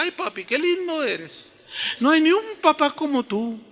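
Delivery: high, syrupy praise; adoring daughter. Retold or imitated. Child praising papi as the sisters would, high and adoring. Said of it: "ni le decía".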